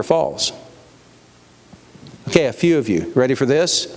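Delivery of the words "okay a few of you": ordinary talk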